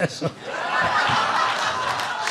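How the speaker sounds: a soft airy laugh at his own joke; breathy laugh. Real, not acted. Comic laughing at his own joke, soft breathy laugh. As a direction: laugh under the breath, nearly silent.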